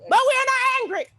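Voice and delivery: high, loud denial; angry tone. Acted. Performer denying anger in a high, loud outburst. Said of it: "Let me change my tone".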